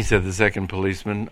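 plain reading voice

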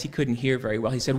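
ordinary delivery

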